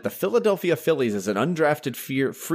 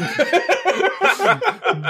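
high burst of laughter; 4 dB louder than usual. Real, not acted. Curse joke.

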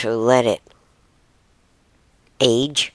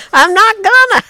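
loud, very high refusal; stubborn raccoon; 9 dB louder than usual. Acted. Second raccoon refusing once more, loud high refusal. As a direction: dig in with a high, loud squeal. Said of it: "The other raccoon says".

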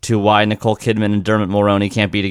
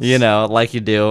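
ordinary talk

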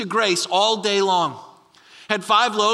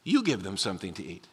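much lower and softer, a calm firm answer; Jesus, calm and firm; 13 semitones lower, 8 dB quieter than usual. Retold or imitated. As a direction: drop low and quiet, settled and sure. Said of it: "and he says".

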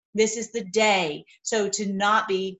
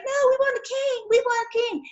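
put-on much higher childish whine; high-pitched petulant mock crowd; whiny demanding people; 13 semitones higher than usual. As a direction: whine like spoiled kids demanding a king. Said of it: "they're like".

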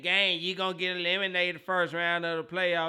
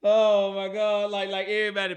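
exasperated; 3 semitones higher, 10 dB louder than usual. Real, not acted. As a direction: drag it out in disbelief. Exasperated about the loss.

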